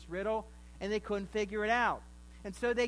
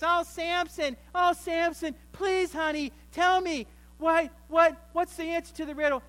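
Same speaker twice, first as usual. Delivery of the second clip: high, louder pleading wheedle; nagging wife; 7 semitones higher, 6 dB louder than usual. Retold or imitated. New wife nagging Samson for the riddle answer, high pleading wheedle. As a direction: coax it out of him, high and whiny. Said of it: "she begins to nag him already".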